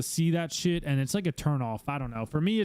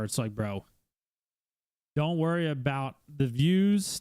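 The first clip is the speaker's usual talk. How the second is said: usual speaking voice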